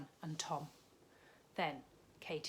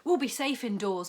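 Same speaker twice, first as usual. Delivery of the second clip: puffing; 5 semitones higher, 13 dB louder than usual. A narrator's reading. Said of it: "puffed Mum".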